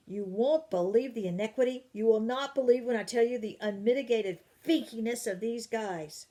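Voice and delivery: loud, indignant complaining; father fuming about his colleagues; angry outburst. Acted. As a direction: vent the outrage at full volume. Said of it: "He sounded very angry".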